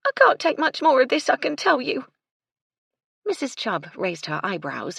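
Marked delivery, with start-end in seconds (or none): 0.1-2.1 put-on high, loud, grumbling servant girl; exasperated maid's voice; fed-up housemaid. A narrator's reading. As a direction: complain crossly, pitched right up.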